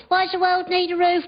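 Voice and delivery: put-on high, curious child's voice; child's question. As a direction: ask it like a small kid. Child voiced in parent scene.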